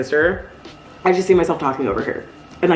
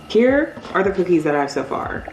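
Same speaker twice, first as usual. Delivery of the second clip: ordinary talk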